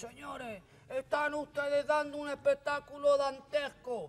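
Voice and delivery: stern godfather. Retold or imitated. Godfather stands and scolds the rowdy guests, slow grand outrage. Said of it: "Se levanta y dice".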